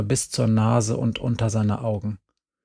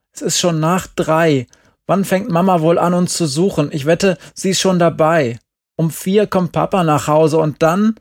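urgent; 6 semitones higher, 6 dB louder than usual. A narrator's reading. Glenn urgently pushes to leave.

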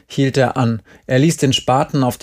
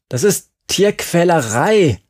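high-pitched outraged exclamation; outraged cry with swooping pitch; indignant cry; 6 semitones higher, 3 dB louder than usual. A narrator's reading. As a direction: call it out as an accusation. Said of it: "rief Jerry".

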